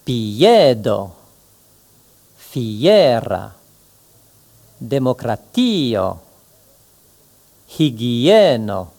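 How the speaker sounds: piedo, fiera, democratio, hygieno with every vowel in its own syllable; Esperanto vowels apart. Shown as a demonstration. Teacher demonstrating separate vowels to students. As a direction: split each vowel pair clearly, slowly. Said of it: "C'est ainsi qu'il faut dire".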